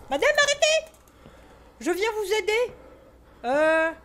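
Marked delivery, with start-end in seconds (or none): 0.1-0.9 high, loud "arrêtez!" flung across the room; shout at the ghost. Real, not acted. Player shouting at the game's ghost lady, loud and high. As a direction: yell at her to stop.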